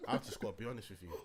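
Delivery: low, contained anger; fuming. Real, not acted. Podcaster refusing to look at the camera, quietly fuming. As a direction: hold the temper down, voice dropped. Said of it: "I'm fucking fuming".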